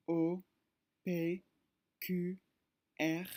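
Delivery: reciting the French alphabet letter by letter; French letter names. Shown as a demonstration. Teacher reciting the alphabet to students, French letter names. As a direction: spell out each letter the French way. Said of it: "look at their pronunciation".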